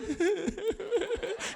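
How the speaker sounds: high-pitched half-sob, half-giggle; crying-laughing kid imitation. Uncle imitates nephew mid-ride, high crying-laugh. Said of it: "that in-between thing that kids do".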